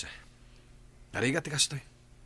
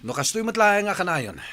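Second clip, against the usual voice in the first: raised, sharp angry voice; angry outburst; 8 semitones higher, 7 dB louder than usual. Acted. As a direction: snap back at her partner in a fight. Couple quarrel, shouting back. (nagpungtot a panagsao)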